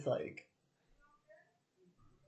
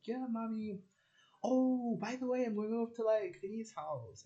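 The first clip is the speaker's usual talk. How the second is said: higher, bright-casual announcement to mum; lightly voiced teenage self, higher; breezy kid voice; 10 semitones higher than usual. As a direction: toss it off to mum. Voicing her younger casual self.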